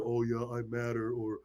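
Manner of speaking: mumbled, soft, half-hearted affirmation; half butt statement. Retold or imitated. Mocking a lazy affirmation in the mirror, soft and half-hearted. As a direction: say it like you don't believe it. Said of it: "you give some half butt statement".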